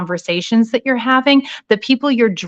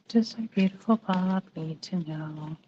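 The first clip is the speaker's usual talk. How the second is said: murmured under breath; 2 semitones lower, 12 dB quieter than usual. Real, not acted. Murmurs while shuffling.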